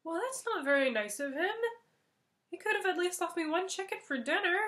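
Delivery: a touch of petulant letdown; lightly voiced sulky master; mild complaint. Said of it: "sits back for a moment and says".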